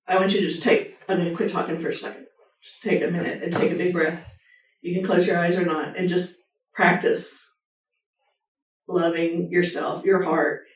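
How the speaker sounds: softly paced meditation voice, easing them into calm; guided meditation. Acted. Speaker guides listeners into self-compassion meditation, soft and calm. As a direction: talk them gently into a breath.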